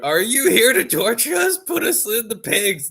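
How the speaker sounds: high, loud frightened plea; a demon's high, loud begging; pleading demon. Retold or imitated. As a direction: beg like a cornered demon. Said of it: "the demons going".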